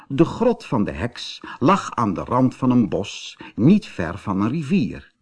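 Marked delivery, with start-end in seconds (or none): none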